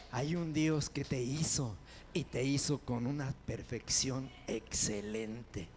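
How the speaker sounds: normal talking voice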